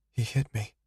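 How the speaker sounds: high, small quiet voice. Acted. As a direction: barely say it. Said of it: "her voice so quiet I almost missed it".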